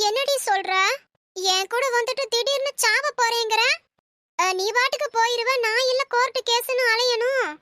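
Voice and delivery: normal talking voice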